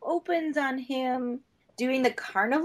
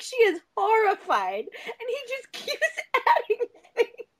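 laughing; 8 semitones higher than usual. Real, not acted. Laughing retelling hot dog scene.

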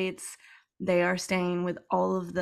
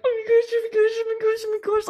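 rapid, much higher excited chant; squealing into fist; 12 semitones higher, 4 dB louder, faster than usual. Retold or imitated. Reader reacting to each sentence with high squeals. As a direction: squeal over and over, barely containing it. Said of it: "I'm screaming into my fist".

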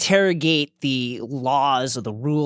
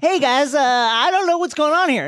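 mock confused author; 10 semitones higher, 4 dB louder than usual. Mocking the author's confession.

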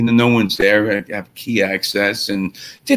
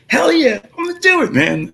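much higher, thrilled yes; eager yes. Retold or imitated. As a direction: jump at the chance. Agreeing to play with Exodus.

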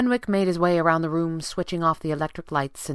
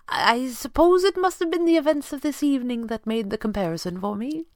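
higher, careful butler voice; awkward butler; 9 semitones higher than usual. A narrator's reading. Butler explaining his concern to his employer, awkward. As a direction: justify yourself politely to the boss.

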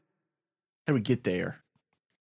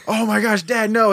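mock dismay; 9 semitones higher, 4 dB louder than usual. Son embarrassed by dad onstage.

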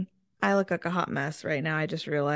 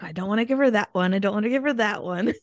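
fast mock protest; faster than usual. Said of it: "And I was like".

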